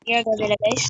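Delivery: ordinary delivery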